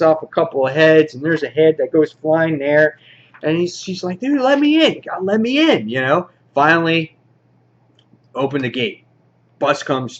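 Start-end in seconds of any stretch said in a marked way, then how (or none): 4.2-5.9 high-pitched, swooping plea; acted pleading, pitched way up; mock urgent plea. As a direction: beg in a squeaky put-on voice. Pleading to be let in, retold.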